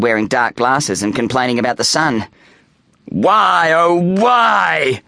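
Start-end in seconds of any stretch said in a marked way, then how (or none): 3.1-5.0 high, drawn-out theatrical wail; shouting lament. A narrator's reading. Boy vampire complaining about the sun, theatrical shout. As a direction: cry out in hammed-up anguish. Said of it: "he would shout".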